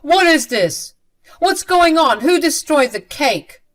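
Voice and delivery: acted outburst of anger; angry. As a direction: demand answers, angrily and loudly. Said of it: "Leo angrily said".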